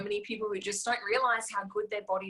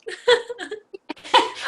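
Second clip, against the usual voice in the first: loud high laugh; high burst of laughter; 13 semitones higher, 12 dB louder than usual. Real, not acted. Laughing at own joke.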